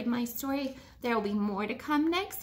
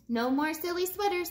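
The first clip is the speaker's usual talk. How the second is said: ordinary delivery, nothing marked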